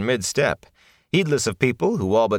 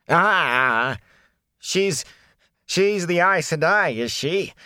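wary peddler; 11 semitones higher, slower than usual. Acted.

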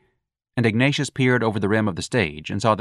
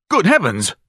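alarmed exclamation; 8 semitones higher, 5 dB louder than usual. Acted. Bird attacks Ignatius.

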